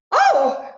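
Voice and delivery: sharp high shriek of fright; sudden loud frightened gasp; startled scream. Acted. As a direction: jump and cry out. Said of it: "Oh, you frightened me".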